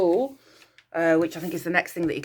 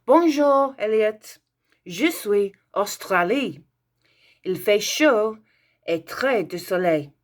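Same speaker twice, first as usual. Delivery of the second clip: reading a French postcard aloud; 3 semitones higher, 4 dB louder than usual. Acted. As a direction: read the pupil's postcard proudly to the class. Reads pupil's French postcard aloud.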